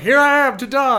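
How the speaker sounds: loud high yell, jumping out; mock-dramatic shout from the woods; hammed-up yell of a naked stranger; naked guy yelling. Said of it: "He's like".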